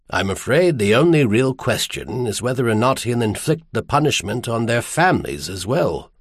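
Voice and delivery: usual speaking voice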